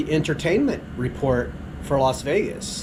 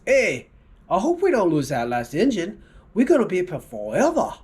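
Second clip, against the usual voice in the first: high, louder joking local guy; quipping local; 6 semitones higher, 4 dB louder than usual. Acted. Local guy joking to his wife on the plane, higher and louder. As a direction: toss off the punchline to the wife.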